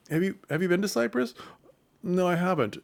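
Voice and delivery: stilted couple making conversation; lightly mocked awkward lovers; awkward small talk.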